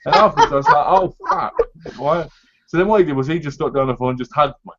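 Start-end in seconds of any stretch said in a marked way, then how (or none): 0.9-1.5 high squeak of dismay; shocked. Retold or imitated. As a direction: yelp it in alarm. Dismay after falling.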